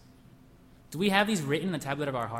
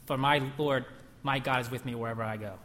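ordinary talk

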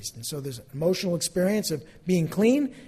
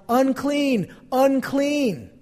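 warning yell; 8 semitones higher, 5 dB louder, much slower than usual. Acted. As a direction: call out loudly, higher pitched. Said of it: "they had to yell".

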